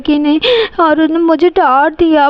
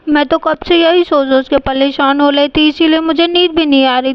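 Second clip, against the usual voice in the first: usual speaking voice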